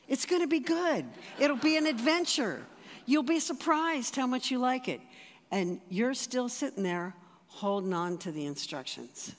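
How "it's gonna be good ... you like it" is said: high, loud, bubbling with promise; excited Jesus. Acted. Jesus promising the driver a great trip, excitedly high and loud. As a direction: sell the adventure, voice leaping up. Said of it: "And Jesus looks at you and says".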